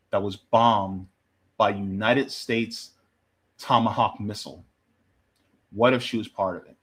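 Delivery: low, grave, with heavy pauses; somber reflection. Real, not acted. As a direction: let each phrase sink in, pausing between. Grave reflection on bombed schoolgirls.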